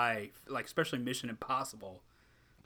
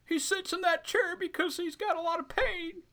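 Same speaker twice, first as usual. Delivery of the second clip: mock crying; 4 dB louder than usual. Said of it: "somebody's crying now".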